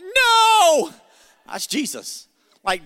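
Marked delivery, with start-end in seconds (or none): 0.0-0.9 high, loud, drawn-out "No!"; mock panicked yell. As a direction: wail it like a kid facing Mama's belt. Child seeing Mama get the belt.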